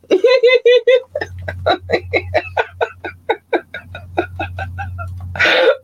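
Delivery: high, wheezy giggle that trails away; helpless high laughter; long laughing fit. Real, not acted. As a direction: crack up and keep laughing until breathless. Cracks up at own slip.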